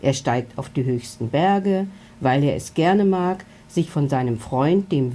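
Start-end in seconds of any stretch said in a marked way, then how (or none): none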